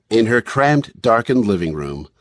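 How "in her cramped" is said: ordinary delivery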